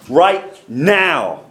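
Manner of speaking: each word set apart, "now" drawn out; stress on NOW. Shown as a demonstration. Preacher insisting not five minutes from now, stress on "now". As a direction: land slowly and firmly on "now". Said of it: "I'm gonna emphasize that word now".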